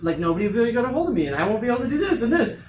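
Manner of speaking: rushed, rising panicked chatter, fast; fast, half-joking panic over a dying phone; mock panic. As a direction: play up the phone-battery panic at speed. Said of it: "'cause I'm like".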